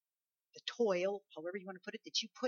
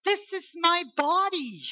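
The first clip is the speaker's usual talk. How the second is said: loud, high, slow proclamation; Jesus quote; 9 semitones higher, 7 dB louder, slower than usual. Acted. Preacher quoting Jesus at the supper, proclaimed. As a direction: proclaim it slowly with full preaching force. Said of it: "Jesus said".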